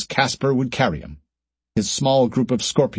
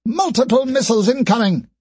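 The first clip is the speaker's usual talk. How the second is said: alarmed shout; 11 semitones higher than usual. Acted. Operator alarm.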